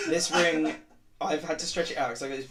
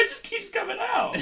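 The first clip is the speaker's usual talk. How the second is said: laughing protest. Real, not acted.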